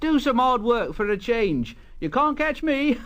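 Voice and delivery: high, teasing little engine voice; cheeky taunt. Acted. Thomas teasing sleepy Gordon, high and cheeky. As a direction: tease the big engine from a safe distance.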